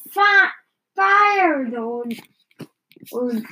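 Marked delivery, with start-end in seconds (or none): 0.1-1.7 high, loud alarmed yell; yelling fire. A narrator's reading. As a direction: shout the alarm at full pitch. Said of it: "old yelled".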